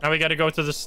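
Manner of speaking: ordinary talk, nothing marked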